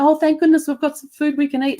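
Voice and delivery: high, loud, hammed-up American tourist sigh of relief; mock relieved tourist. Mocking Americans heading into McDonald's, hammed-up high relief. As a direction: send up the tourists with exaggerated relief. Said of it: "Americans walking into McDonald's going".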